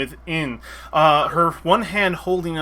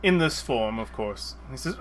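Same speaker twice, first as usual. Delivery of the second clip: ordinary talk, nothing marked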